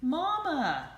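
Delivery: high, loud happy call; excited cry. A narrator's reading. Ducklings excitedly calling the rabbit mama. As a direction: shout with joy. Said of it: "cried the ducklings in excitement".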